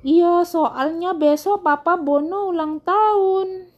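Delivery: put-on high, slow girly character voice; girlish voice. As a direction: draw it out in a high girlish storybook voice. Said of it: "jadi kayak cewek suaranya".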